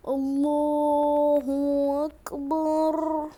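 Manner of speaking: loud, very slow chant-like exclamation; jubilant drawn-out cry. Real, not acted. Player hailing a lucky arrival, jubilant drawn-out cry. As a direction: stretch each word long and loud in triumph.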